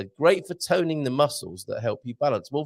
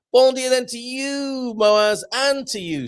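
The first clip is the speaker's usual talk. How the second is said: sing-song greeting; 11 semitones higher, 5 dB louder, slower than usual. Acted. Drawn-out greeting to viewers.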